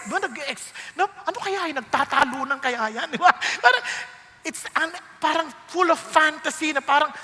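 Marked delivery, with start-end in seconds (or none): none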